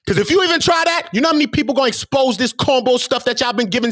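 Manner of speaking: usual speaking voice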